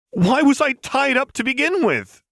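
high, loud indignant demand; angry demand. Acted. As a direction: demand an answer, outraged. Lux furious after waking up.

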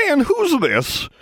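slow, high crone; old crone voice. A narrator's reading. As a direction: ask it like a nosy old woman. Ancient crone asks.

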